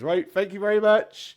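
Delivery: loud, high showman's voice; brisk stage thanks. Acted. Comedian closing after everyone claps, projected. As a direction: project your thanks to the room.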